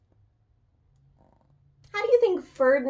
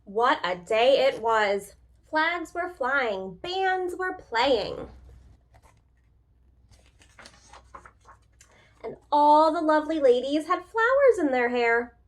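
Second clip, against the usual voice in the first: story narration; 3 semitones higher, 3 dB louder than usual. A narrator's reading. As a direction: paint the festive parade for children. Reads the parade scene aloud.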